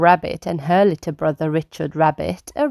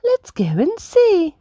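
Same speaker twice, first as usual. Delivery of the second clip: higher, coaxing mother voice; gentle Mummy Rabbit; 12 semitones higher than usual. Acted. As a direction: coax her little son kindly. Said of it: "says Mummy Rabbit".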